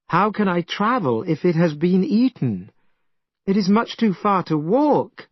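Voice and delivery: mild cry. Acted. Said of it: "cried Tripitaka".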